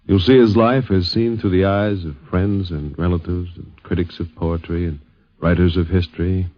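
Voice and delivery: formal broadcast introduction; announcer intro. Acted. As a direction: open the show like a radio host. Program opening announcement.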